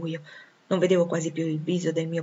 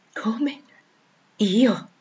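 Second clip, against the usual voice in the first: startled, higher questioning; exclaimed disbelief; 4 semitones higher than usual. A narrator's reading. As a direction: react in astonished disbelief. (incredula, con stupore)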